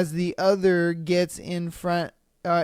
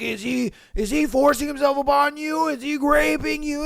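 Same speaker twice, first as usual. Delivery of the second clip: cop; 7 semitones higher than usual. Retold or imitated. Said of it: "then they're like".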